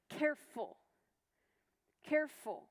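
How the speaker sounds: careful said twice, each set apart by a pause; emphasized key word. Shown as a demonstration. Teacher naming the key word to the class, careful stressed. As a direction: land careful on a falling tone, pause, then repeat it. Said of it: "key words there being".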